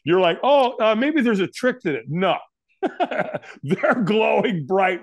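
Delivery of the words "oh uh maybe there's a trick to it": hopeful mock thought. Nervous firewalker reasoning with themselves at the coals, hopeful. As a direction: kid yourself there's a trick, pitched up.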